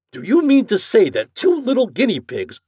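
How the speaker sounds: high, loud disbelieving question; incredulous protest. A narrator's reading. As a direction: protest in amazement at the charge. Morehouse incredulous at the bill.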